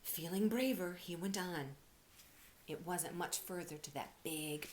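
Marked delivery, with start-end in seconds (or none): none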